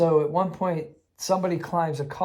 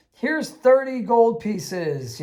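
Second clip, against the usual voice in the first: slow, higher mock ghostly voice; spirit voice; 7 semitones higher, 4 dB louder, much slower than usual. Spirit at the altar rewarding a praying player, slow mock voice. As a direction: drawl it out like a generous spirit.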